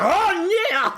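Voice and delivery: high, loud, drawn-out oh yeah; mock excited oh yeah. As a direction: squeal it like a hyped fan. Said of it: "I was like".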